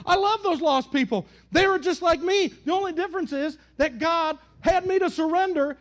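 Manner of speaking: high, loud impassioned sermon run; fervent preaching. Acted. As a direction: preach it with full fire. Passionate preaching peak.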